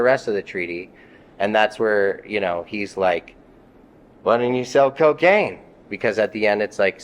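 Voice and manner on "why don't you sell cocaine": mocking cop. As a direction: taunt him with the question. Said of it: "So he's mocking me".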